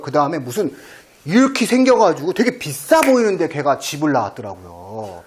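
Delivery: ordinary delivery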